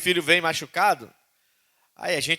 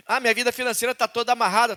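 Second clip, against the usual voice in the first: higher, louder whiny excuse; complaining believer; 9 semitones higher, 5 dB louder, faster than usual. Retold or imitated. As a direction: whine about your troubles.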